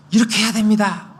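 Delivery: high, loud urgent appeal; urgent exhortation. Shown as a demonstration. Preacher demonstrating an urgent call to the crowd, high and loud. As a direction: call the crowd to act now. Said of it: "하면서 외치고".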